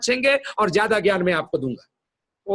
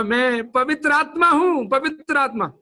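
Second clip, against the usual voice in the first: Holy Spirit speaking; 7 semitones higher than usual. Retold or imitated. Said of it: "तो बोला".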